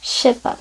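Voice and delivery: je sais pas slurred to shé pa; very casual French. Shown as a demonstration. Teacher shows the most casual form. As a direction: collapse it to shépa. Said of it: "It's how people speak".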